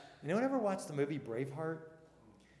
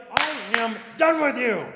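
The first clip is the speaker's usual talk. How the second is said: much louder, higher, giving up on someone; fed-up dismissal; 8 semitones higher, 14 dB louder than usual. Retold or imitated. Pastor voicing people giving up on others, fed up. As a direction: slam the door on them. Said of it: "we would be like".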